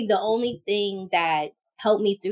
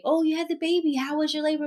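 nosy acquaintance; 8 semitones higher, faster than usual. Retold or imitated. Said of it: "you're just like".

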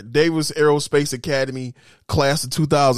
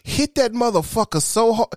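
usual speaking voice

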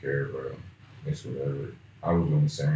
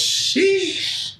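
high sheesh; 3 dB louder than usual. Real, not acted. Reacting to dating drought.

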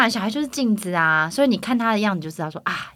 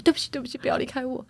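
higher, softer begging; pleading apology; 5 semitones higher, 6 dB quieter, faster than usual. Retold or imitated. Husband right after a fight begs his wife, higher and softer. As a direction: beg them not to leave, softly. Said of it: "然后立刻说".

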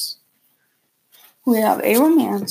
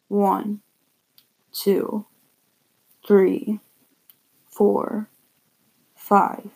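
ordinary delivery

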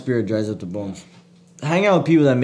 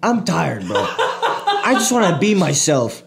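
fed-up outburst, higher pitched; sfogo esasperato imitato; 8 semitones higher than usual. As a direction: retell a friend who's had enough. Retells friend's fed-up outburst.

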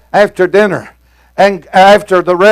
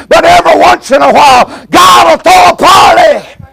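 fiery preaching; 6 semitones higher than usual. Acted. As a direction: drive it home to the congregation. Preaching at full force.

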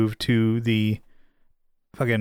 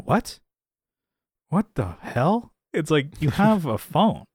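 higher-pitched, incredulous retelling; mock disbelief; 5 semitones higher, slower than usual. Grown child baffled by dad's tape answering machine, mock disbelief. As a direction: act out baffled disbelief, drawing out the words. Acts out baffled reaction to dad.

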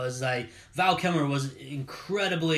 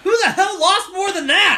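high, loud disbelief through a laugh; laughing outburst; 13 semitones higher, 8 dB louder than usual. Real, not acted. Cohost laughs at the pick, loud and laughing. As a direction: blurt it out mid-laugh.

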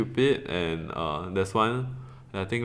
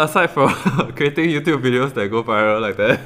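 laughing; 4 semitones higher, 7 dB louder than usual. Real, not acted. Laughing after the viral story.

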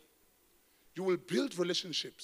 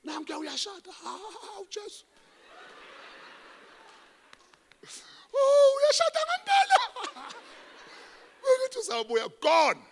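high squealing laugh through the words; laughing disbelief; 8 dB louder than usual. Retold or imitated. Pastor laughing at the news his ex is marrying, high-pitched. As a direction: laugh it off, shocked and amused.

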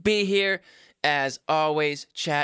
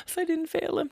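fretting mother; 12 semitones higher, 4 dB quieter, faster than usual. Acted. Mother falsetto.

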